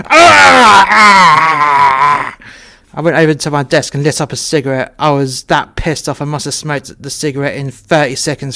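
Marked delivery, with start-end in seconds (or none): 0.1-2.4 very high, very loud cry of anger; raging yell. Retold or imitated. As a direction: howl it out while punching the wall. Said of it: "shouted again".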